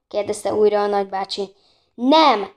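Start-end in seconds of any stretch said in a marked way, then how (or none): 1.9-2.5 loud, raised, offended refusal; indignant denial. A narrator's reading. Girls answer uncle's question about being thieves, loud indignation. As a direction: deny it hotly in one burst. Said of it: "rázták a fejüket a lányok felháborodva".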